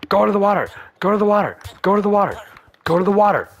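high, loud repeated urging; frantic shouts to reach the water; urgent shouting. Real, not acted. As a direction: yell it over and over, desperate. Urging burning golem to water.

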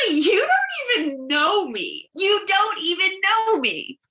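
heated. Acted. Drunk Sydney argues with Harry.

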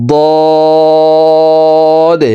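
"দদ" with the vowel held very long on a steady tone; four-alif stretch. Shown as a demonstration. Teacher showing how the letter is stretched, long level hold. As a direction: draw out "দদ" like a chanted note. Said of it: "দদ হরফ চার আলিফ টান হবে".

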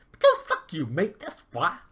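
very high, loud cartoon squeal, ending in a laugh; Poos's squeal. Head dwarf Poos mocks the prince, very high and loud. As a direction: snap it back in a shrill dwarf voice. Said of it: "said Poos".

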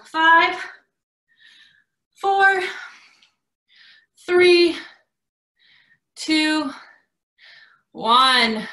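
loud countdown called to the class; calling out the countdown. Real, not acted. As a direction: call each number out over the workout. Coach counts down burpee reps.

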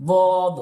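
বদ said loud with দ from the tongue side; loud dad demo. Shown as a demonstration. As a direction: hit the দ in বদ hard. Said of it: "ওখান থেকে উচ্চারণ হবে". (জোরে দ উচ্চারণ)

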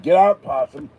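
loud high shout to shoo an animal; yelling at possum. Retold or imitated. Shooing the baby possum out of the house, loud high yell. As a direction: bark it out to chase the possum off. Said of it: "I yell".

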